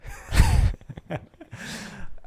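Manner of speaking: sudden high airy laugh; burst of laughter. Real, not acted. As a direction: laugh out loud, breathy. Laughing after the rap.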